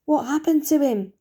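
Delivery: spluttering. Acted. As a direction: blurt it out in alarm. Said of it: "he spluttered".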